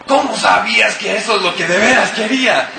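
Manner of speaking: put-on high, loud, amazed child voice; delighted surprise. Little daughter amazed her dad guessed she wanted gum. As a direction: squeal it like an amazed little girl.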